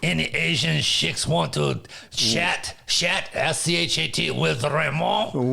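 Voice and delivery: slow, hammed-up French lounge-lizard accent; mock French accent chatting up the room. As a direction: purr it out as a fake French charmer. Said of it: "speak with this French accent".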